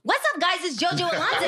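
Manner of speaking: high, peppy mock DJ greeting; fake radio voice. Shown as a demonstration. Host shows friends a fake radio voice on request, high-pitched DJ voice. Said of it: "what would your fake ass radio voice sound like".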